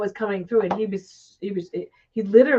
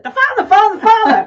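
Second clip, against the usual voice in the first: laughing high mock repeat; 14 semitones higher, 14 dB louder than usual. Quoting the reader's repeated words.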